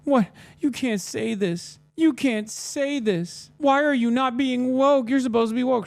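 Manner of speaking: high, slow, nasal-free mock whine of an outraged fan; hammed-up high, slow mockery of upset fans; mocking whiny fan. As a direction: sneer it out like a triggered follower, way up high and drawn out. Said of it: "They're like".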